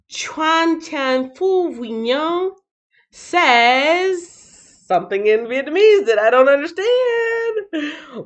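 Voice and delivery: slow, high, stretched-out read; playful announcing. Real, not acted. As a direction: sing out the name and draw out each phrase. Drawn-out read of foreign comment.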